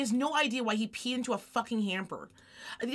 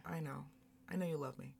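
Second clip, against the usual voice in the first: very soft and low; quiet, subdued acceptance; tender retold reply; 5 semitones lower, 12 dB quieter than usual. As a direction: answer gently, almost under your breath. Retelling her tender reply to daughter.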